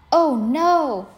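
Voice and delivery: loud, high storybook "oh no"; dismayed cry. A narrator's reading. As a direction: exclaim in alarm, voice jumping up. Narrator voices dismay at cat's change.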